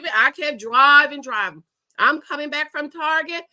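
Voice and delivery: usual speaking voice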